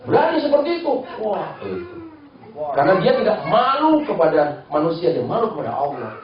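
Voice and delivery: ordinary talk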